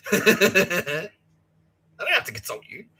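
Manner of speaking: mock gloating through laughter; laughing boast.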